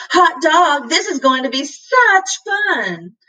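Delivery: cat thrilled to go out, lightly voiced; delighted. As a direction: burst out with delight. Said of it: "He spun around in sudden pleasure".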